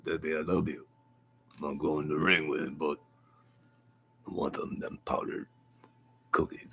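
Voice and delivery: soft, mumbling boxer impression; Rocky. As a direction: lovestruck boxer trailing into a hushed mumble.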